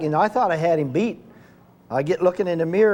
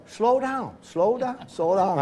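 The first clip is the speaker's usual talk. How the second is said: higher, insistent repeated plea, tapering off; urging slow down; 6 semitones higher, much slower than usual. Retold or imitated. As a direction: mimic Pearson pleading to ease off, pitched up. Pearson urging him to slow.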